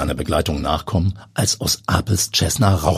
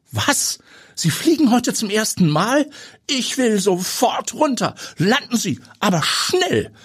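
Eva panicking on hearing it is his first flight; voice cracking with panic. Acted. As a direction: beg to land right now. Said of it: "Eva Engels Stimme überschlägt sich".

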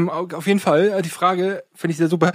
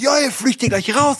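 retold shout; 7 semitones higher than usual. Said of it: "hat die Lehrer uns so angeschrien".

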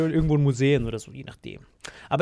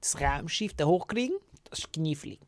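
hushed, unvoiced musing; imagined Elon Musk; 8 dB quieter than usual. Pretend Elon Musk weighing up a rocket launch, hushed musing. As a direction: half-whisper it as if pondering a puzzle. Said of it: "Dann sagt er".

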